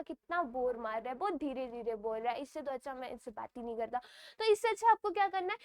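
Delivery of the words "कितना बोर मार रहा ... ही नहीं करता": a hint of a bored listener, quieter and lower; muttered complaint of a bored listener. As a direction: grumble it like a fed-up listener. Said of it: "तो बोलेगा".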